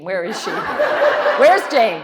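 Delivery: half-laughing shout for Jane; laughing call. Retold or imitated. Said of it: "But I'm like".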